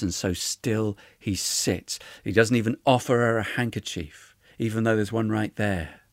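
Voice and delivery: ordinary delivery, nothing marked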